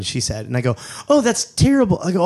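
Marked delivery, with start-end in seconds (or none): 1.1-2.0 high-pitched "oh, that's terrible"; mock concerned tone, pitched up; feigned sympathy. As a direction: react with overdone concern, voice raised high. Feigned sympathy in retold exchange.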